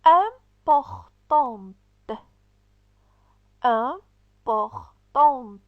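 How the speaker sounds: deliberate teacher word drill; slow articulated words. Shown as a demonstration. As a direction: spell each word out aloud for pupils. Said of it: "suivez avec moi la lecture".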